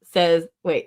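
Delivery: usual speaking voice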